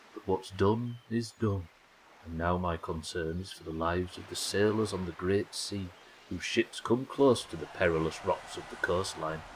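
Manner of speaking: measured, unhurried storytelling voice; solemn ghost captain. Acted. Ghost captain asking a human for help, solemn. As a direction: intone it like a weary old spirit.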